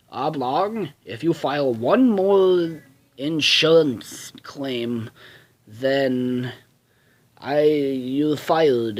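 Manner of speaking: slow, drawn-out rich-man villain; rich boss voice. Acted. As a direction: threaten an employee like a wealthy cartoon boss. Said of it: "he's the rich man, and he's like".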